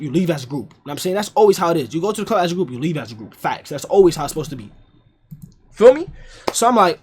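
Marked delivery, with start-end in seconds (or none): none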